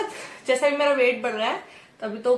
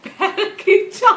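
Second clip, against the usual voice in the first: slow words broken by a giggle, pitched up; laughing; 9 semitones higher, 11 dB louder, slower than usual. Real, not acted. Telling viewers about the massage, laughing. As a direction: giggle through it, can't hold it in.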